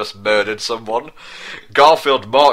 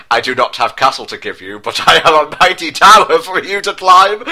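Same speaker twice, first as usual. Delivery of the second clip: mock seduction; 6 semitones higher, 7 dB louder than usual. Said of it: "Garfield said with seduction".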